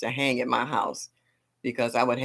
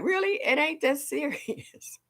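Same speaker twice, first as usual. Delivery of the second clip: high, slow and sassy; sassy dismissal; 13 semitones higher, 3 dB quieter than usual. Retold or imitated. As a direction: brush off their attitude, drawn out and high. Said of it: "And I'm just like".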